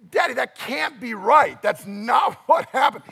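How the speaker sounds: put-on loud, high cry of protest from the kids; children's protest. Preacher imitating his sons crying out when Aslan dies, loud and high. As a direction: shout it like a kid who can't accept the ending. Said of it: "they're like".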